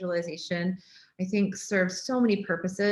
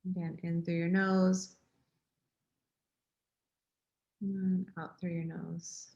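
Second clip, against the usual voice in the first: guided meditation; 2 semitones lower, 5 dB quieter than usual. Real, not acted. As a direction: ease them gently into the breath, unhurried, softening.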